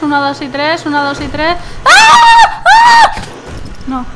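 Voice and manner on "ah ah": very high, loud shriek; loud scream. Real, not acted. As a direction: scream as you leap. Screaming jump. (grito agudísimo)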